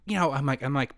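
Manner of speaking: usual speaking voice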